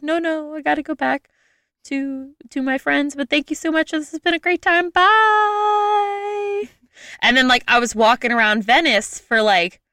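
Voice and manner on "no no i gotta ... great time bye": polite refusal. Retold or imitated. Turning down the gondolier to rejoin her friends, high sweet politeness. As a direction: let him down sweetly, voice up high. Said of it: "And I was like".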